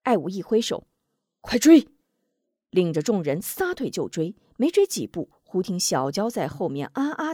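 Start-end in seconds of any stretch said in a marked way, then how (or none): 1.4-1.9 loud, sharp order; rally them to run; urgent command. A narrator's reading. Ai Wu ordering everyone to chase, loud urgent command.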